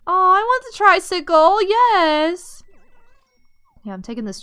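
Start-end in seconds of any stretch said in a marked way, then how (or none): 0.1-2.6 high, loud excited squeal; thrilled squeal. Real, not acted. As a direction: squeal with delight over it. Thrilled at finding the tricycle.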